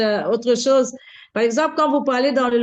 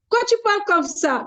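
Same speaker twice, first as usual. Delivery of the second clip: higher-pitched quoted question; retold puzzled challenge; 8 semitones higher than usual. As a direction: imitate a puzzled shopkeeper, pitched up.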